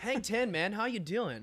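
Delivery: high, laid-back surfer voice; surfer dude. Pretend. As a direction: greet him like a beach surfer. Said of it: "the most radical of dudes".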